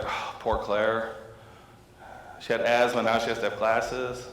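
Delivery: mildly bummed, slow sighing sympathy. Retold or imitated. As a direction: imitate a disappointed mother lightly. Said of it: "my wife is super bummed".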